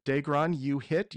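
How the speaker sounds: usual speaking voice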